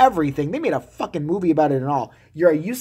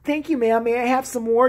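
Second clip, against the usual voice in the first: meek husband; 7 semitones higher than usual. Retold or imitated.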